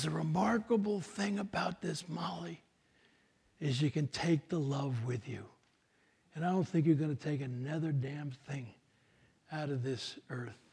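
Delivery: soft, tender parting words; hushed farewell. Retold or imitated. Dying man's farewell in retold film.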